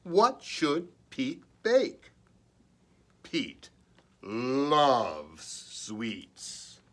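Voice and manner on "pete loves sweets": plain reading voice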